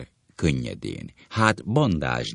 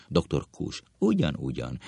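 ordinary delivery

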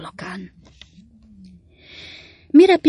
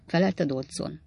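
ordinary delivery, nothing marked

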